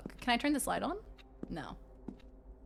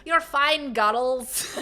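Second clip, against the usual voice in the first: slow, louder Scottish imitation; Scottish accent; 4 semitones higher, 6 dB louder, slower than usual. Streamer quoting the concert lad, Scottish accent. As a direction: drag out "guttles" in a mock Scottish accent. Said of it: "he said we were fine guttles".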